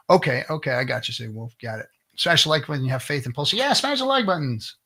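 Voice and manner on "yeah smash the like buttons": high, loud, drawn-out hype call; mock hype streamer voice. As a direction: ham up the like-and-subscribe plug. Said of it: "I, I never say that".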